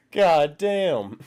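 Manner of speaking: ordinary talk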